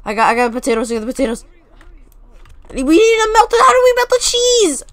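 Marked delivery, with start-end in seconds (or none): 2.7-4.8 high, loud, urgent question; panicked. Real, not acted. Player lost on melting cheese, panicked. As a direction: cry out for help, pitched way up.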